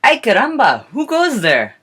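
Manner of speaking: loud, high theatrical challenge; calling out. Acted. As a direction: call out like a little storybook swordsman. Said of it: "And so Skippy calls out".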